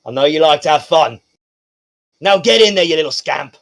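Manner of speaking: loud, playful send-off; indulgent guard. Acted. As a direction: shoo the kid along fondly. Guard sends Pippa in.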